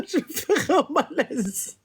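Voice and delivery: slow, laughing through the words. Real, not acted. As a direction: giggle as you say it. Laughs at her own act.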